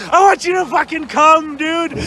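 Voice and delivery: ordinary talk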